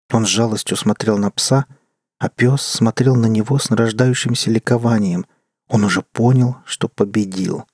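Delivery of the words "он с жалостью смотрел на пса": ordinary delivery, nothing marked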